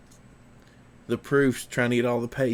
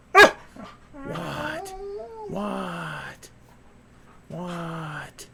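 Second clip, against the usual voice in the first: dog voice; 7 semitones higher, much slower than usual. Acted. Owner voices his dog.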